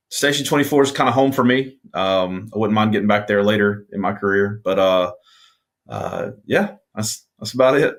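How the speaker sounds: ordinary delivery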